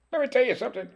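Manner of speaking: squeaky, very high playful repeat; silly high voice. Pretend. Cook playfully bragging to viewers, silly squeaky voice. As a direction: jump way up into a squeak.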